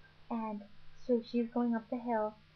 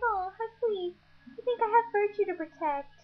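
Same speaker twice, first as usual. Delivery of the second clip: much higher and louder, mock sweet; sarcastic Nessie; 12 semitones higher, 4 dB louder than usual. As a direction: coo it with teasing sarcasm. Nessie mocking her father.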